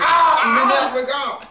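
laughing exasperation. Real, not acted. Teammate fails the charge again.

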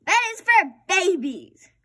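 high, loud scornful retort; child declaring bed is for babies; defiant. A narrator's reading. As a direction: sneer at bedtime.

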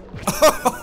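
high-pitched gleeful laugh; delighted laugh. Real, not acted. Delight at a backflip grab.

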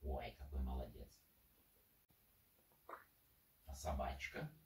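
ordinary talk, nothing marked

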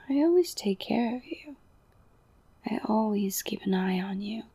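ordinary talk

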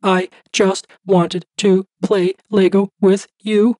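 put-on high, slow little girl on the verge of tears; tearful child. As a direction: plead through held-back sobs. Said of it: "between great heaves just shy of sobbing".

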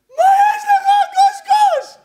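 very high, very loud squealing shout; squeaky mocking yell at a lovesick boy. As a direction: shriek it in a ridiculing squeaky voice. Mocking the young man.

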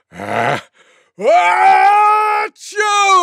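loudest sneeze. Acted. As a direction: explode into a huge pretend sneeze. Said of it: "gave the loudest sneeze".

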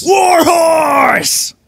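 big, high shouted ring call; emphatic shout. Shown as a demonstration. Co-host showing how to say the wrestler's name, emphatic shout. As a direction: belt out the name with full emphasis. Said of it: "You gotta say it with emphasis".

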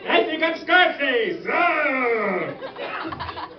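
put-on high, excited manic man-child; Pee Wee Herman voice. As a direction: squeal it like a coked-up Pee Wee. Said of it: "what if Pee Wee Herman".

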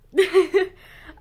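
sudden loud burst of laughter. Real, not acted. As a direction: break into a big loud laugh. End of video, laughing burst.

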